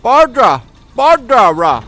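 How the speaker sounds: high hollered name; calling out. Pretend. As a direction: shout for someone lost. Calling for game character.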